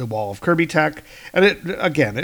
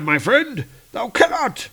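raised heroic archaic voice; Hercules voice; 11 semitones higher, 4 dB louder than usual. Acted. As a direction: play the old-fashioned demigod hero. Voices Hercules reading comic.

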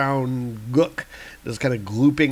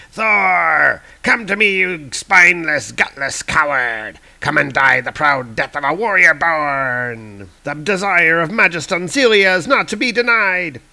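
loud, high villain's roar; villain scream; 4 semitones higher, 5 dB louder than usual. Acted. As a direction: bellow a challenge at Thor. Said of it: "And Pericus is screaming".

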